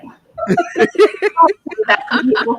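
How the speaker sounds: high, drawn-out plea through laughter; laughing plea. Retold or imitated. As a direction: beg in mock embarrassment, laughing. Retells plea to daughter, laughing.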